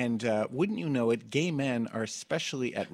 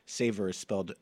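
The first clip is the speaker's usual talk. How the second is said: ordinary delivery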